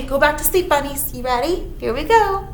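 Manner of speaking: ordinary delivery, nothing marked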